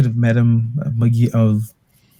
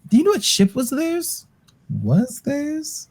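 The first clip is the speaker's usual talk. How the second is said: high character voice; 11 semitones higher, 4 dB quieter than usual. Acted.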